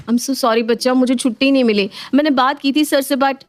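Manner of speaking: lightly acted apology to a child; apologetic mother. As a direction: explain yourself to a hurt child.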